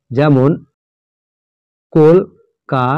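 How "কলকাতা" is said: Kolkata with long gaps, কল, কা, তা; syllable by syllable. Shown as a demonstration. Example of a trisyllable, Kolkata split as কল, কা, তা. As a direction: separate all three syllables of Kolkata. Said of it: "একটি word তিনবারে উচ্চারণ হয়".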